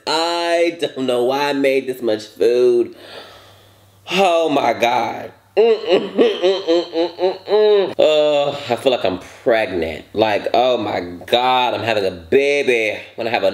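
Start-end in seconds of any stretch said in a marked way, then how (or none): 5.6-7.9 full groans. Real, not acted.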